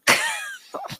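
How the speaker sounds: high squealing laugh; real laughter breaking out; burst of laughter. Real, not acted. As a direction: crack up at the chat's jokes. Host cracking up at chat.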